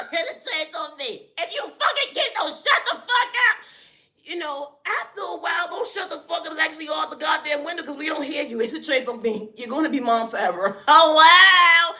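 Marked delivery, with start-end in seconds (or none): 1.4-8.6 much higher, hammed-up shout at the kids; yelling mom. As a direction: scream at the noisy kids, over the top.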